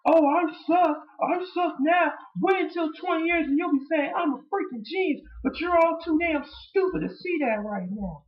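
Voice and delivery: high squeaky mock tantrum; whiny Punk impression. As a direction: pitch it up like a crybaby champ. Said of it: "He's like".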